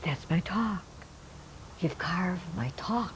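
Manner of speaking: slow, hushed, awestruck whisper; awed whisper. Retold or imitated. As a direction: breathe it out in wonder. Old man awed by his words.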